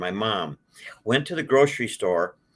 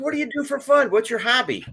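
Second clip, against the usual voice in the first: boss's question pitched far higher and louder; imitating the boss; 14 semitones higher, 3 dB louder than usual. Franchise founder pressing the applicant about hobbies, high mock voice. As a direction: squeak out the boss's puzzled question.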